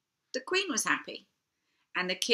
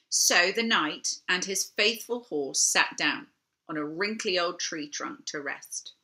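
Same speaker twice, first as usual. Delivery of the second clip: plain reading voice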